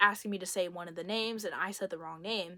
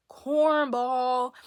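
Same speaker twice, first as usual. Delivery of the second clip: loud, higher-pitched jeer; mocking name-calling; 4 semitones higher, 9 dB louder than usual. Storyteller jeering at the man over his text, mocking. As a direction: call it out in open mockery.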